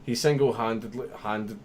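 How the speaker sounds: stumbling, restarting mid-word; tripping over words. Real, not acted. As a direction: get tongue-tied and keep restarting. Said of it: "that was difficult to say".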